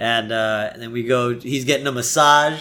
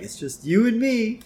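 Homer; 10 semitones higher than usual. Retold or imitated. Acting out Homer cooing to Maggie.

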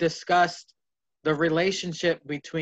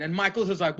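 ordinary delivery

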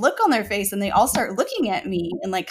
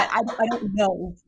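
ordinary talk, nothing marked

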